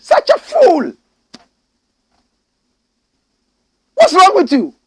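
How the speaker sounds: very high furious yell; outraged shout. Real, not acted. Berating the president in a furious high shout. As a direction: scream it at the president.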